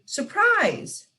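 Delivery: high, bright shout of surprise; group scream. Acted. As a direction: burst out like a room full of friends springing a surprise. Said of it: "they all scream".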